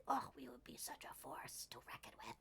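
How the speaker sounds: breathy conspiratorial whisper, quick; mock-secret whispered boast; playful whisper. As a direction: lean in and whisper it like a secret plan. Imagined team-up with other streamer.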